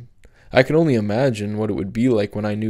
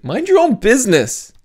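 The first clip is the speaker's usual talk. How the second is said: much higher, loud shriek; 12 semitones higher, 7 dB louder than usual. Acted. Sophie shrieking at Cap, high loud scream. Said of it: "Sophie shrieked".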